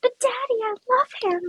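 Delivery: very high, pleading princess voice; high-pitched melodramatic plea; Little Mermaid. Retold or imitated. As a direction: mimic a lovestruck daughter begging her father. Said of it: "And then it's that crescendo".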